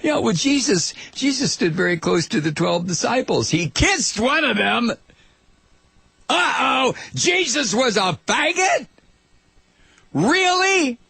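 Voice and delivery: high, mocking put-on voice of a scandalized right-winger. Host mocking right-wingers on his show, high scandalized mock voice. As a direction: ham up the outraged bigot, pitched well up. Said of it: "according to Jerome Corsi and the rest of these right-wingers".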